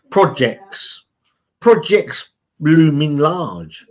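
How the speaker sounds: slow, drawn-out "Projects looming large" with a hint of doom; mock ominous. Host joking about busy chatters' projects, mock ominous and slow. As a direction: let it loom.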